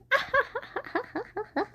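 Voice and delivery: high staccato cackle; soft, high evil laugh; mock villain cackle. Villain laugh after bomb threat.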